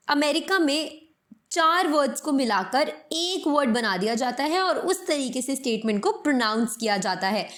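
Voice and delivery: normal talking voice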